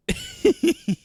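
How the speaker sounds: sudden high loud burst of laughter. Real, not acted. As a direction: crack up loudly at your own bit. Bursts out laughing at own joke.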